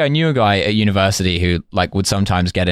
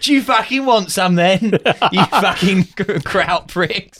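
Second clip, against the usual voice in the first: high, hammed-up British brawler taunt, half laughing; mock British hooligan accent; 10 semitones higher, slower than usual. British soldier taunting the Germans, comic hooligan accent pitched high. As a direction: goad them like a British hooligan, laughter breaking in. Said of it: "do the accents from this film".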